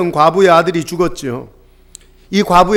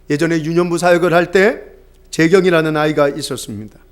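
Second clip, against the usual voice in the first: ordinary talk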